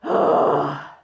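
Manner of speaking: low, loud airy sigh; open throat sigh. Shown as a demonstration. Demonstrating vocal cords open, airy sigh. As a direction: open throat and let the air out. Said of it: "you want your vocal cords to be open".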